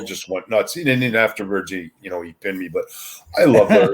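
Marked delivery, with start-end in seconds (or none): 3.4-3.9 laughing while saying it, pitched up and louder. Real, not acted. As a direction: let a laugh spill through the words. Fond memory, laughing.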